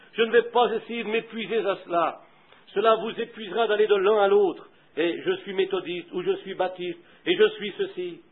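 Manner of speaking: ordinary delivery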